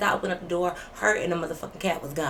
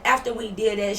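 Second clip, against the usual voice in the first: usual speaking voice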